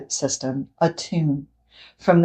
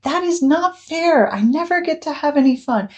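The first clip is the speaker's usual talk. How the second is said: put-on child yelling; 10 semitones higher than usual. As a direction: shout it like an upset kid. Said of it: "and yells".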